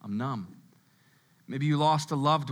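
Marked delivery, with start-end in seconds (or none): 0.0-0.6 low, soft, given-up voice; numb resignation. Acted. Preacher voicing someone numb after a breakup, resigned. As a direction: say it drained and detached, low and quiet. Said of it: "now you're just like".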